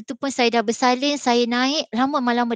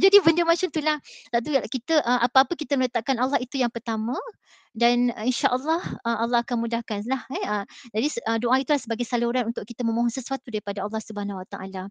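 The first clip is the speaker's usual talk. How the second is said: ordinary talk, nothing marked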